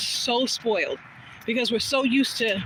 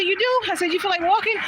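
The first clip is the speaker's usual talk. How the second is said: surprised; 7 semitones higher than usual. Retold or imitated. Surprised at friends joining.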